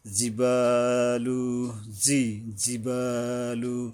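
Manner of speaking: slow জিবালু with a long ba; one-alif stretch. Shown as a demonstration. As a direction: recite জিবালু, drawing out the বা. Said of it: "এক আলিফ টানতে হবে এখানে". (জিবালু-র বা এক আলিফ টেনে পড়া)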